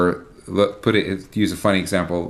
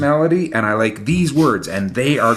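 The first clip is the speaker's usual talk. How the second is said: determined songwriter; 7 semitones higher, 4 dB louder, faster than usual. Retold or imitated. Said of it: "they're determined".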